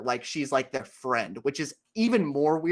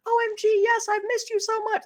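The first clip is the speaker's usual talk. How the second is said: very high, squealing gushing friend; gushing girl. Retold or imitated. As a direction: squeal it like an excited girlfriend. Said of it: "And then they respond".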